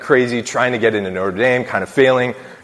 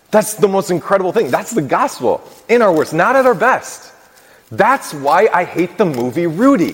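fervent preaching; 7 semitones higher than usual. Acted. Priest to the congregation, preaching with rising fervor. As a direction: lift the congregation up with a rising burst of zeal.

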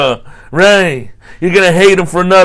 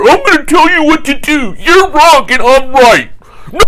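bossy administrator; 8 semitones higher than usual. Retold or imitated. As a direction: lecture them like a petty boss. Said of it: "that administrator".

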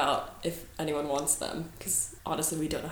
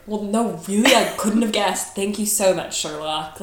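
mock-amazed sarcasm, a bit louder at first; sarcastic; 3 semitones higher, 5 dB louder than usual. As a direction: thank them with heavy sarcasm. Sarcastic retort to test result.

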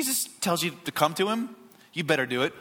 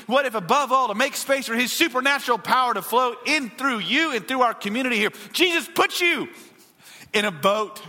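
raised-pitch, driving sermon delivery; fervent preaching; 8 semitones higher, 4 dB louder than usual. Real, not acted. Pastor challenging the church with what-ifs, fervent preaching. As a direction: build urgently through each repeated question.

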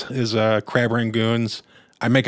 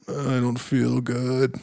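put-on sick kid; 4 dB quieter, slower than usual. Boy voiced, sick.

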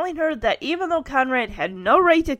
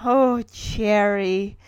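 mock lovestruck; 2 semitones lower, much slower than usual. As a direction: stretch it out like a smitten schoolboy. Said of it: "says the same thing to Cherry".

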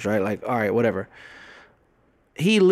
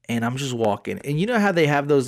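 ordinary talk

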